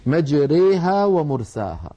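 slow recitation with مجريها said as mejreha and ومرساها fully open; recited with imala. Shown as a demonstration. Teacher reciting Hafs's reading, slow with مجريها bent. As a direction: recite slowly, bending only مجريها toward e. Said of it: "هكذا رواها حفص".

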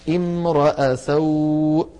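slow, drawn-out "امرأ", "i" first and "a" after the r; initial kasra, following ra. Shown as a demonstration. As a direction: chant out "امرأ" slowly as "imra'a". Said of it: "ويبدأ بهمزتها مكسورة". (تلاوة «امرأ» ببطء بكسر الهمزة وفتح الراء)

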